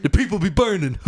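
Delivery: badly imitated excitable cartoon sidekick; Eddie Murphy. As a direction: call out in a hammed-up star's voice. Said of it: "It's a bad Eddie Murphy impression".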